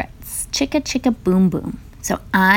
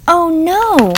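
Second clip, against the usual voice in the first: high, louder hammed-up "oh no"; mock alarm; 10 semitones higher, 6 dB louder than usual. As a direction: play up the shock for the kids. Storyteller reacts to tree bending.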